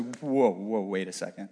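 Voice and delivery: low, hushed, hesitant double-take; insecure inner voice. Acted. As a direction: stop short and question it under your breath. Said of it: "my mind immediately goes to".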